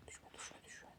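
breathless whisper, barely audible; tense whisper. Real, not acted. Gamer hiding from Granny, whispering a plea. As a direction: breathe the words so Granny won't hear. Pleading box not fall, hiding.

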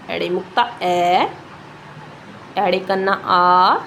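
slowly name each sign, then stretch its vowel ਐ, ਆ; teacher's slow demo, drawing out the closing ਐ and ਆ; vowel pronunciation demo. Shown as a demonstration. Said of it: "ਇਹਨਾਂ ਨੂੰ ਬੋਲਣ ਦਾ ਤਰੀਕਾ ਦੇਖੋ".

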